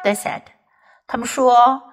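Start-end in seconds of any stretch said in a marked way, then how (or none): none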